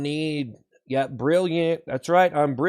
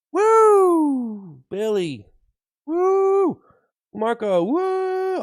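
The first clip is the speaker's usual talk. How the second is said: excited cheering; 10 semitones higher, much slower than usual. Acted. Cheering viewer names.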